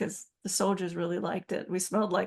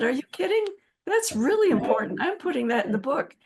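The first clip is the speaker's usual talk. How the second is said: surprised insistence; 6 semitones higher, 4 dB louder than usual. Retold or imitated. Co-author insists on the anecdote.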